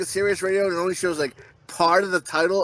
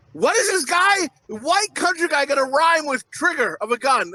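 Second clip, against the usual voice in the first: alarmed anticipation; 6 semitones higher than usual. Retold or imitated. Replays anxious suspense at lyric.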